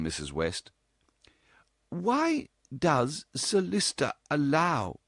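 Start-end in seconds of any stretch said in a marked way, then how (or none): 1.9-5.0 high, slow, hesitant mother; mother's voice. A narrator's reading. Mother begins questioning her daughter, slow and hesitant. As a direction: start the question then falter.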